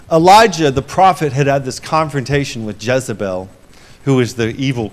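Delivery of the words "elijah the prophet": dramatic announcement. Real, not acted. Preacher launches story.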